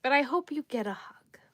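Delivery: breathy whispered aside; a soft whisper to a viewer who wants a hug; whispered wish. Real, not acted. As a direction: whisper it gently.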